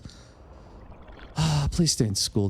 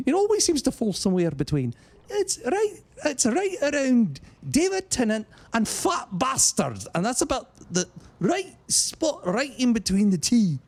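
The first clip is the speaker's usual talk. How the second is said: a higher, lively Scottish accent for the explanation; 12 semitones higher than usual. Shown as a demonstration. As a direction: keep talking in a light Scottish accent.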